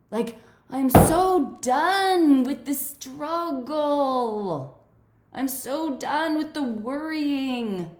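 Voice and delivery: high, slow and strained, on the edge of tears; tearful. Real, not acted. Venting to the call about being done with the struggle, tearful.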